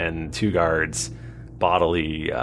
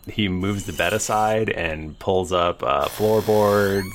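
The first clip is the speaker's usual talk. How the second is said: ordinary delivery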